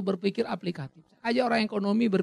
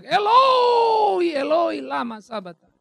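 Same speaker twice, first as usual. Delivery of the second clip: long, high, loud cry falling away; acted anguished shout, fading at the end; 7 semitones higher, 5 dB louder, much slower than usual. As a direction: cry out to heaven in a drawn-out shout. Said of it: "Lalu dia berteriak".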